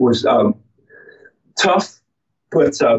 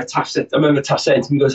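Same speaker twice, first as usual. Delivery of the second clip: ordinary talk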